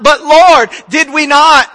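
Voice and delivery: loud, high cry of protest; desperate plea. Acted. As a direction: call out as if begging the judge. The rejected plead on judgment day.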